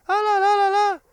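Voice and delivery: very high, loud falsetto "la la"; childlike falsetto. Shown as a demonstration. Teacher showing learners what falsetto is, in childlike falsetto. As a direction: flip right up into a child's voice. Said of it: "trying to talk like a child, like this".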